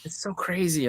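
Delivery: lightly mimicked astonishment; imitated dumbfounded. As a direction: echo her stunned disbelief. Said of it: "she's dumbfounded by the whole thing".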